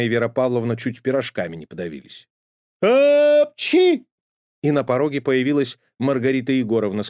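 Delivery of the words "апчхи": huge high loud sneeze; booming cartoon sneeze; thunderous sneeze. A narrator's reading. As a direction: sneeze so the house shakes. House-shaking sneeze.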